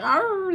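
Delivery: high barked arr; dog bark. Retold or imitated. Owner imitating her dog's bark. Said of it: "He's like".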